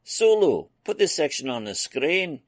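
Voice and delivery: calling out the order in a rush of excitement; an eager engineer hurrying the helmsman. Acted. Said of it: "he said eagerly".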